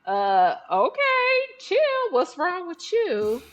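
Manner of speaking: high, incredulous partner's reply; startled partner. Retold or imitated. Partner reacting to an angry driver, high and incredulous. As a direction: pitch way up in disbelief.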